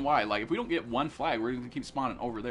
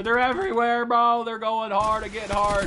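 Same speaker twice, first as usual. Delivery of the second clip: EVERYWHERE louder and higher; alarmed warning; 7 semitones higher, 8 dB louder than usual. Real, not acted. Player warning his bro that enemies are everywhere, alarmed shout. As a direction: raise the alarm, pitched up and loud.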